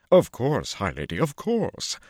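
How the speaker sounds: high, loud, fawning showman. A narrator's reading. Lucca bowing to Tuon, fawning and eager. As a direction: grovel eagerly with each bow.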